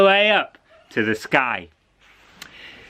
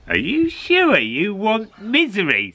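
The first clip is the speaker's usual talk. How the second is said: put-on old lady; 7 semitones higher than usual. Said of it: "said the old lady".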